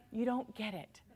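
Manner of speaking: remorseful king. Acted. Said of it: "I feel remorse".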